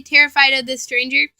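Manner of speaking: plain reading voice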